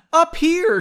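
high, loud call that cracks; calling up, voice cracking. A narrator's reading. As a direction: shout it up the hill and let the voice crack. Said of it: "apparently having her voice crack".

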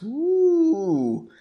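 high, sustained "ooh"; mock-spooky ooh. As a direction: coo in mock wonder. Mock awe at magic handcuffs.

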